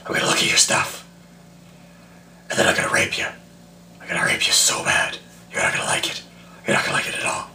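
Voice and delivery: raspy whispered menace throughout; Crack Fox alter ego. Retold or imitated. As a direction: creep the listener out with a breathy threat. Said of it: "make some stuff up".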